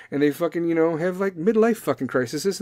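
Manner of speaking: usual speaking voice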